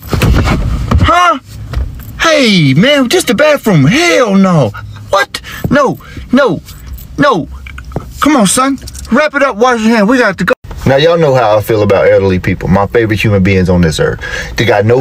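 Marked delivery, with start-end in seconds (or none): 0.0-7.5 high-pitched, incredulous dad protest; alarmed disbelief. Acted. Dad interrupting a stranger in the bathroom, high-pitched alarm. 8.2-10.5 high-pitched, flustered dad; urgent hurrying. Acted. Dad hurrying his son out, high and urgent.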